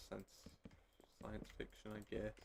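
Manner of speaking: whispering the correction; barely voiced aside; whispered. Real, not acted. Muttered correction to himself.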